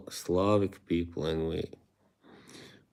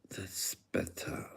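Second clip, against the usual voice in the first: whisper; 12 dB quieter than usual. Real, not acted. After fixing the camera, hushed.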